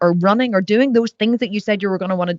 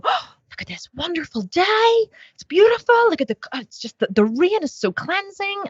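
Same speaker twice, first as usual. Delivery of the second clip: mock delight; 11 semitones higher than usual. Describing the mood change on a rainy walk, high gushing delight. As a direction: gush about the rainy day, hammed up. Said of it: "you go".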